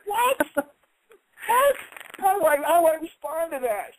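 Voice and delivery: stunned disbelief. Retold or imitated. Told he can't hit him, shouting in stunned disbelief. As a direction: yell out in total disbelief. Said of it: "I was like".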